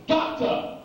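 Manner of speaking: high, explosive shout of a greeting; sudden loud, high call; Avery Brooks. Acted. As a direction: boom it out like a big entrance. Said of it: "when he comes into work and he's like".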